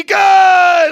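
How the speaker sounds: loud, high excited "Good!"; excited shout. Acted. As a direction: shout it as the shot drops. Made three in call.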